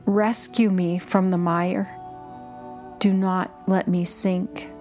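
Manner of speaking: ordinary delivery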